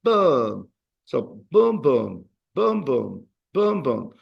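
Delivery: punched "boom" beats, strong then weak; second-last syllable emphasis. Shown as a demonstration. Teacher drumming the stress pattern for students, strong-weak beats. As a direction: beat out the stress in pairs of "boom". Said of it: "emphasis is on the second last syllable".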